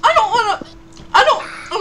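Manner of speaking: whiny protest. Real, not acted. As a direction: whine it out in protest. Still refusing the prompt.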